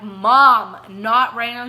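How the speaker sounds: loud exasperated brush-off; imitated irritated outburst, first word hit hard; aggravated, annoyed. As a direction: re-enact snapping loudly at your mom. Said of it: "I was aggravated and annoyed".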